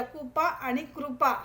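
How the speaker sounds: कुपा then कृपा, slow, the second with a full कृ; contrasting pronunciation. Shown as a demonstration. Teacher contrasting कुपा and कृपा for students. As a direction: set कुपा against कृपा slowly. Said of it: "उच्चारण नीट लक्ष द्या".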